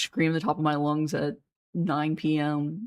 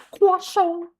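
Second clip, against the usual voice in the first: very high squeaky cartoon voice; Carl Wheezer. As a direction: imitate the character, voice pushed way up. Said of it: "it's kinda squeaky".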